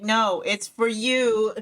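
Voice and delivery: high, loud, slow insistence; imitating boyfriend. Boyfriend refusing payment, mock insistent. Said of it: "He's like".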